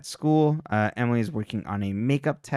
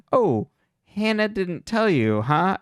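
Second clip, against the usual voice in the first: taunting; 7 semitones higher, slower than usual. Retold or imitated. Taunting reveal quote in recap.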